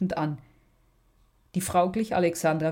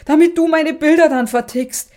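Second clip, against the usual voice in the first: much higher and louder, losing all control; shrieking; 9 semitones higher, 10 dB louder than usual. Acted. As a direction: scream it as the nerves snap. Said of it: "kreischte Magda".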